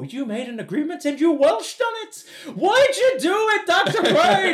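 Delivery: high-pitched hurt superfan calling out the doctor; high nerdy Noel voice. Retold or imitated. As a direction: squeak out the accusation like an aggrieved fan. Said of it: "Noel's like".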